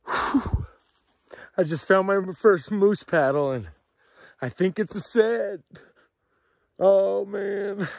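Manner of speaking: high and loud, slow with emotion; real, choked-up joy; emotional joy. Real, not acted. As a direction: overcome with happiness. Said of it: "I'm emotional".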